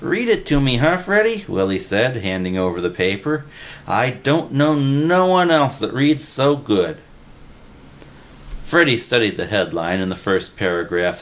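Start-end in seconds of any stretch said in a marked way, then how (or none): 0.0-1.5 high, whiny pleading; pleading Willie. A narrator's reading. Willie begging Freddy to read the paper, high and pleading. 3.9-7.0 put-on slow, high admiring kid voice; eager Willie. A narrator's reading. Willie praising Freddy's reading, slow and high.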